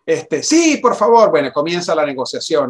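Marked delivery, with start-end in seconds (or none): 0.4-1.3 retold plea, high and loud; eager plea. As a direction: act out jumping at the offer. Replays eager plea to caller.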